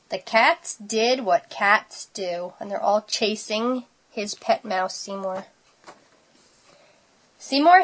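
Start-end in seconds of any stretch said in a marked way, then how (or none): none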